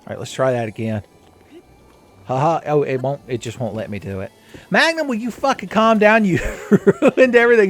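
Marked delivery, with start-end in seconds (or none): 4.7-7.7 loud, high, laughing yell at the horse; mock angry yell. Player scolding horse Magnum, laughing mock angry yell. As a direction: blow up at him, half laughing.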